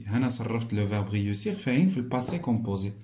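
usual speaking voice

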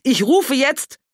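high, loud furious shout; yelling. Acted. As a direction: threaten him at full volume. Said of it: "Inzwischen brüllte Johanna".

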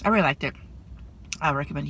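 talking between bites; chewing while talking; mouth full, chewing. Real, not acted. Seen 2: Bites sandwich at -1.0; chews while speaking until about 3.2 s.

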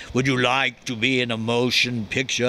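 lightly imitated grand Orson Welles; a touch of the grand director making an offer. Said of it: "Mister Wells with his voice would say".

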